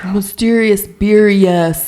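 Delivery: dramatic announcer. Pretend. Echoing the mysterious beer intro.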